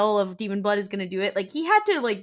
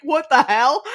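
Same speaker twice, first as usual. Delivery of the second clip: louder, higher baffled outburst; mock confusion; 4 semitones higher, 7 dB louder than usual. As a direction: imitate someone utterly thrown. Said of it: "She's so confused".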